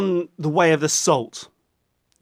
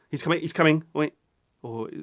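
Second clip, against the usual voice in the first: ordinary delivery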